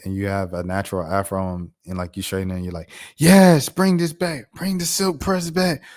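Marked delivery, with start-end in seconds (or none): 3.2-5.8 high, loud hyped-up approval; exaggerated high-pitched enthusiasm; mock excited cheering. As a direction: cheer it on like an overexcited fan. Voicing approving fans of straightened hair.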